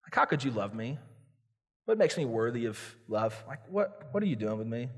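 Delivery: low, soft self-loathing moan; imitated wallowing confession, low and soft; self-pitying despair. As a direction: sink into mock self-pity, voice dropping. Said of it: "We beat ourselves up before God".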